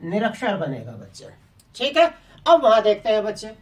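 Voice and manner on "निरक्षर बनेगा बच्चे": low answer voice. Shown as a demonstration. Teacher answering her own question for the pupils, low and soft. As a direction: lower and softer, the pupils' answer. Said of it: "तो आप कहोगे".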